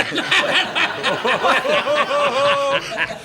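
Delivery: laughing protest. Real, not acted. Protesting while laughing hard.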